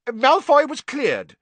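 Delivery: high, loud and affronted; affronted minister. A narrator's reading. Fudge affronted at Harry's accusation, high and loud. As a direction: bristle at the name.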